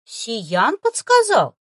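disbelieving echo of her words; surprised. Acted. Boy asks the girl about her familiar, surprised. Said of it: "удивлённо переспросил малец".

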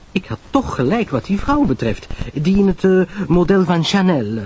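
high, animated society chatterbox; Pamela Lyle, gossiping. Acted. Pamela gossips to Poirot about hotel guests, eager and chatty.

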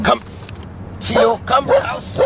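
high, slow call to the dog. Acted. Inspector calls his dog out of the car, high coaxing call.

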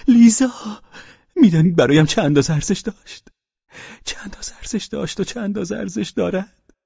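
high, feverish outburst; delirious cry. A narrator's reading. Father cries out about Liza's worth, delirious. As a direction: cry out as if raving.

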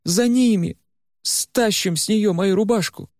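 rally him to chase them; excited urging. Acted. Varya urges the chase. (высоким, взволнованным голосом Вари)

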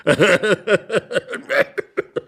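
long, growing peal of laughter; joining laughter. Acted. Intruder finally laughing along with the couple, long peal. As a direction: let the laugh take over at last. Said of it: "Begann zu guter Letzt auch Iwan Andrejewitsch zu lachen".

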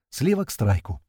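usual speaking voice

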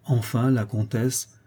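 ordinary delivery, nothing marked

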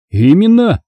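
high, emphatic exclamation; exclaiming. A narrator's reading. Thief agreeing he is a thief, exclaiming. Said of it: "воскликнул Дэн".

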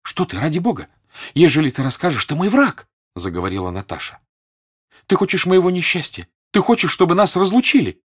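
high, loud, urgent accusation; agitated, high-pitched reproach; alarmed protest. A narrator's reading. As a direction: protest in rising panic. Natasha's frightened protest to Sonya.

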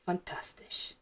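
dry whispered aside; sarcastic whisper. A narrator's reading. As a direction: breathe it out with bitter irony. Severus's dry inner sarcasm.

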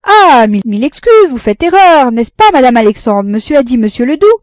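very loud, high-pitched protesting apology; flustered protest. Acted. Café keeper insists to customer that he misheard, loud and flustered. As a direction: protest loudly and appeal for backing.

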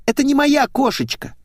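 put-on very high girlish squeal. A narrator's reading. Blonde finds her car is wrong, squealing. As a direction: shoot the voice up into a squeal. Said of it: "взвизгнула девица".

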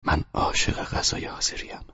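soft whispered line; gentle whisper while watching her cook; quiet voice. A narrator's reading. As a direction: whisper it tenderly. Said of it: "با صدای آرامی گفت".

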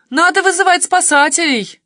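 high, loud neighbour calling out; urgent exclamation. A narrator's reading. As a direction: cry out a sudden urgent idea. Neighbour urges calling rescuers.

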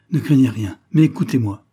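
insistent cry. Acted. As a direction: urge her loudly not to be afraid. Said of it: "s'écria avec plus d'insistance".

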